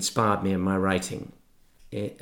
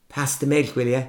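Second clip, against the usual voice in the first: Irish schoolboy; 4 semitones higher, 3 dB louder than usual. Retold or imitated. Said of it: "I'd say things like".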